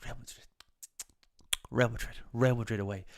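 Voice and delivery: hushed coach impression, pausing between phrases; Guardiola. Fan guessing what the coach will say, hushed imitation. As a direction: start very soft, imitating the manager's halting delivery. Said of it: "tell me if he goes".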